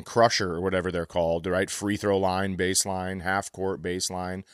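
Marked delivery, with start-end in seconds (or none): none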